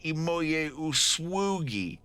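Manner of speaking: drawn-out earnest appeal; earnest offer. Acted. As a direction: offer it carefully, word by word. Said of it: "And he's like".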